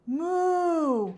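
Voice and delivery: high loud drawn-out moo; cow moo. Acted. Cow calling in the pasture, high drawn moo.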